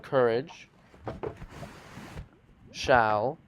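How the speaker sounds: very slow, word-by-word proclamation with long pauses; dramatic sign reading. Acted. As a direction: intone each word separately, pitch swooping.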